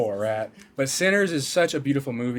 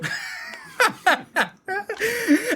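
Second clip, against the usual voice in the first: high laugh. Acted. Laugh inside ad read.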